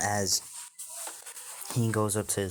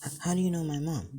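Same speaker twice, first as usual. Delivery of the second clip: high, quick, stuttering question; startled stammer; 8 semitones higher, faster than usual. Acted. Boy asks stranger how he knows his mom, startled high stammer. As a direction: blurt it out, taken aback.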